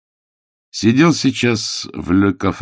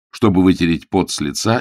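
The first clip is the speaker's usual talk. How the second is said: ordinary delivery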